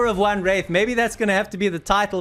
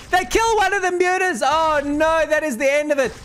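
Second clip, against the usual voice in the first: excited alarm; 8 semitones higher than usual. Real, not acted. Caster reacting to muta loss.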